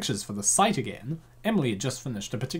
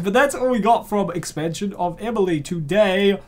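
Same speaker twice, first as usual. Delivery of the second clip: louder, higher sign-off; hyped outro; 4 semitones higher, 6 dB louder than usual. Real, not acted. Reader closing the video excitedly to the audience. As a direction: wrap up with energy. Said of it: "outro to viewers".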